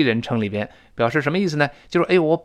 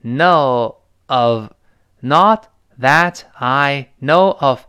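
slow, separated THAT, I, KNOW, OF; word-by-word breakdown; 3 dB louder, much slower than usual. Shown as a demonstration. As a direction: lay out THAT I KNOW OF one word at a time. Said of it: "然后再加that". (逐词拖长慢读)

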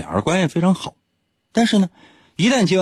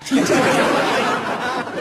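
falling high laugh; bright high laugh; high giggle; 13 semitones higher than usual. Real, not acted. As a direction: giggle at the punchline. Laughs at own joke.

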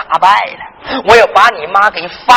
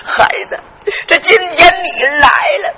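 crying out, pitched up; tearful promise; 6 semitones higher than usual. Acted. Grandfather to grandson promising to free his mother, crying. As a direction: voice breaking with emotion.

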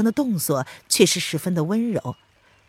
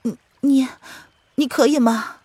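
hesitant, high-pitched question with a stammer; worried; 7 semitones higher than usual. Acted. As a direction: ask him haltingly, unsure he can manage. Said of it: "凌渊有些担心地看着他". (担心而迟疑地轻声问)

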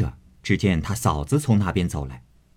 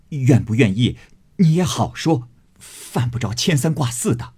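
louder, higher offended retort; stung retort; 5 semitones higher, 3 dB louder than usual. Acted. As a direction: snap back, embarrassed. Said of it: "脸上下不来". (恼羞成怒地回嘴)

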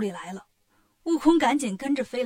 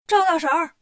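louder, higher call; 5 semitones higher, 7 dB louder than usual. A narrator's reading. Wukong calling the landlady again, loud call. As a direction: shout her name up the house. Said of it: "于是又喊".

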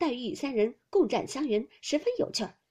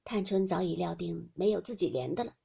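ordinary delivery, nothing marked

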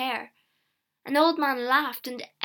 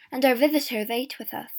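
ordinary delivery, nothing marked

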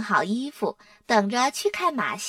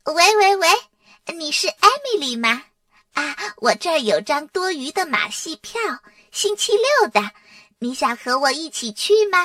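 high, perky, singsong phone voice; showy phone chat; 5 semitones higher, 4 dB louder than usual. A narrator's reading. Dora phones Emily beside Arthur to annoy him, showy. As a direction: chirp loudly into the phone for someone to overhear.

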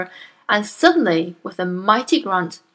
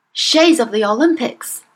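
ordinary delivery

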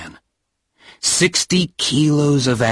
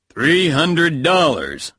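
slow seller voice; 5 semitones higher, slower than usual. Acted. Fat man names price.

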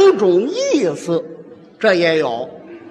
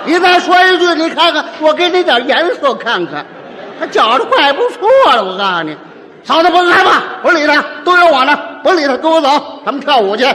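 loud, higher, picking a fight; stirring up the fight; 4 semitones higher, 4 dB louder than usual. Acted. Male peacemaker sides with wife, loud provoking shouts. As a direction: shout him down and side with her. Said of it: "哪有这样劝的".

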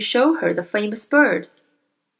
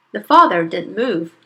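usual speaking voice

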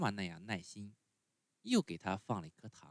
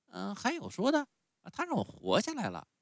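put-on much higher, whiny little boy; puppet boy voice; 12 semitones higher, 4 dB louder than usual. A narrator's reading. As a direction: sulk like a spoiled child refusing medicine. Pinocchio voiced in story.

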